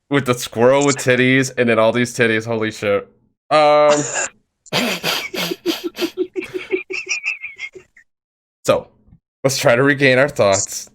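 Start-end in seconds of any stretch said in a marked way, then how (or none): 4.7-7.7 high, soft wheezy laugh; long real laughing fit, nearly silent; helpless giggling. Real, not acted. Host derailed by the squirrel, wheezing with laughter.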